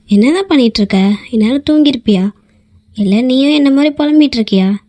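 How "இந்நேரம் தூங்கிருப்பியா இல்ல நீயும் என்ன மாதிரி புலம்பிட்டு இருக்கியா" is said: ordinary delivery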